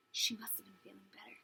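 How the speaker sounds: soft, quick whisper; hushed aside. Real, not acted. Reader's aside to the listener about the hen, hushed aside. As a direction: slip it in under your breath.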